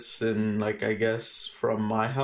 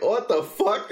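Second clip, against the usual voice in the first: higher-pitched incredulous exclamation; startled "you can't be serious" reaction; retold disbelief; 8 semitones higher, 3 dB louder, slower than usual. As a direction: re-enact being freaked out. Retelling shock at ghost claim.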